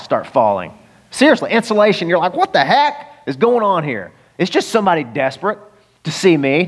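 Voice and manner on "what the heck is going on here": higher, drawn-out incredulous reaction; baffled, put-on protest; mock bewilderment. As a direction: act out a startled onlooker, stretching the words. Congregation reacting to falling ceiling.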